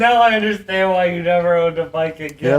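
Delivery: laughing as he speaks. Real, not acted. Laughing at his friend's bike story.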